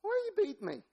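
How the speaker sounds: very high-pitched comic animal voice; plaintive little squeak of protest; talking donkey. Acted. Donkey asks Balaam about beating, high comic voice. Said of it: "donkey just opens his mouth".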